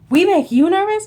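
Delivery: high, loud surprised mimicry; incredulous question. Character astonished by a nervous fan's confession, incredulous question.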